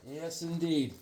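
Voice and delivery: ordinary talk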